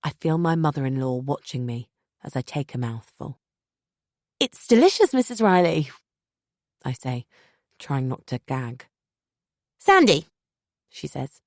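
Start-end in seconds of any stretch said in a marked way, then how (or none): 4.4-5.9 bright, high strained compliment; gushing to hide the gag; forced politeness. A narrator's reading. Narrator to mother-in-law, high forced politeness. 9.8-10.3 high, louder correction of her name; the host insisting on first names; mother-in-law voice. Acted. Mother-in-law to daughter-in-law, high sweet correction.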